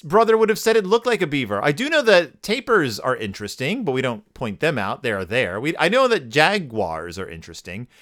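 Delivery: ordinary talk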